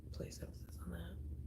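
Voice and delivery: soft breathy whisper under her breath; whispered correction. Shown as a demonstration. Streamer correcting her own words to chat, whispering. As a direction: say it again as a near-silent whisper to yourself. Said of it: "Not try to".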